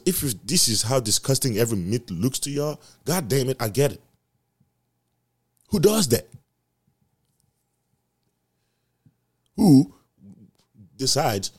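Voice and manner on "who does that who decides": loud, high indignant yell; outraged shout. Real, not acted. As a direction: shout it in disbelief. Outraged rant.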